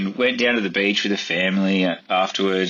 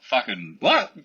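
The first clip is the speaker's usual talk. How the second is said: stunned reaction, pitched higher; retold disbelief; 5 semitones higher, slower than usual. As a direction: replay being floored by the offer. Retold shock at a job offer.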